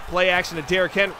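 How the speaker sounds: ordinary delivery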